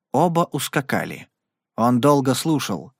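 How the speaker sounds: ordinary delivery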